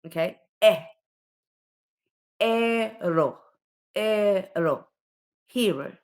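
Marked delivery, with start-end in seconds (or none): 0.6-0.8 isolated, open "Eh" as in enter; eh sound. Shown as a demonstration. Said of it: "it's the eh sound". 2.4-4.9 "Ero" with a loud, stretched eh; eh sound. Shown as a demonstration. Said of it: "it's the eh sound".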